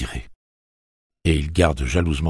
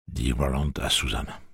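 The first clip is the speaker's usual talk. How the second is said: ordinary delivery, nothing marked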